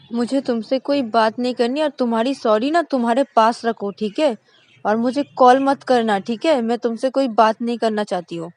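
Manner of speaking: loud, high angry shouting; furious refusal. Acted. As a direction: cut her off in a rage. Shreya refuses apology, angry. (गुस्से में चिल्लाकर मना करना)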